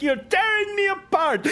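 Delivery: high, slow, shouted outburst; Tommy Wiseau impression. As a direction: yell it like a melodramatic wreck. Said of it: "with Krokus' face on Tommy Wiseau".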